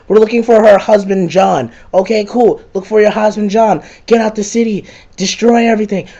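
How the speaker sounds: high, insistent nagging; mock nagging orders. Moya ordering the player about, mock nagging. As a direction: rattle off orders in a high mocking voice. Moya's yelled orders, acted out.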